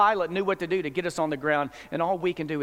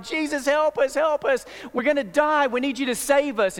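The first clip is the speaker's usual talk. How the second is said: panicked plea; 6 semitones higher than usual. Acted. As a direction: beg him to save them as the boat sinks. Disciples wake Jesus in storm.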